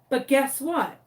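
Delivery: ordinary delivery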